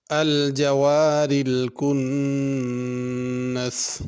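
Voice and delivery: slow, drawn-out chanted recitation; measured melodic tilawat; Quran recitation. Shown as a demonstration. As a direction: recite it like the teacher modelling tajweed. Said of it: "teacher recites verse to model tajweed".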